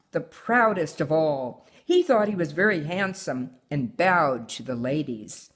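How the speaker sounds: put-on reading a picture book aloud to her child; story reading. A narrator's reading. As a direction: tell the tale gently, like a bedtime story. Mother reads story aloud.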